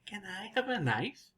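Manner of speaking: "Can I have a knife?" said with a clear rise, ending high; rising tune. Shown as a demonstration. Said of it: "More up, high".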